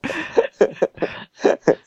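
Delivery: cracking into high laughter; laughing. Real, not acted. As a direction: laugh it out, near tears. Laughing-crying reaction.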